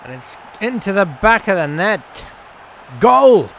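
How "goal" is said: loud, high goal call; excited loud goal; goal shout. Real, not acted. Commentating an equalizer.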